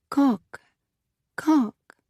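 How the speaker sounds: put-on high French child's pronunciation; short French vowel, pitched high; French accent. A narrator's reading. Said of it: "same accent and inflection the boy had".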